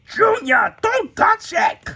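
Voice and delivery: high, loud cartoon yell. Gru warning his junior off the freeze ray gun, yelling. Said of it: "that's Groot yelling".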